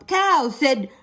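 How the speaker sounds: plain reading voice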